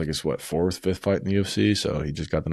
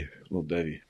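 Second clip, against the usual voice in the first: much softer, murmured repeat to himself; soft echo; 7 dB quieter, faster than usual. Real, not acted. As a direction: let it drop to a pleased murmur. Repeating the drink phrase softly.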